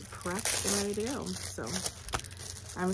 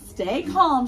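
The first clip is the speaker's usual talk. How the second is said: shout; 5 semitones higher, 8 dB louder than usual. Acted. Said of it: "shouted Owl".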